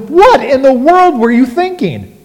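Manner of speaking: loud, high scolding mom voice; exasperated mom. Retold or imitated. Mom confronting her son over melted toys, exasperated scolding. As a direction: demand it like an appalled mother. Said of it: "my mom asked him".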